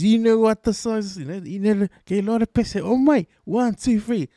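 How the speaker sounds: hype man. Retold or imitated. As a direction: work the crowd up like a hype man. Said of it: "And he, like, he goes".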